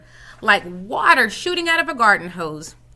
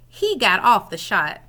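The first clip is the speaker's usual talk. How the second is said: ordinary delivery, nothing marked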